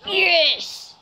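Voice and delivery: high, falling cry of "yes"; triumphant yes. Real, not acted. Player scoring to stay in it, elated. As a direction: exclaim as victory comes in reach. Player scores, could now win.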